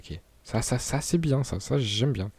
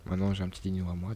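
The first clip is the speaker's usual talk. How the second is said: normal talking voice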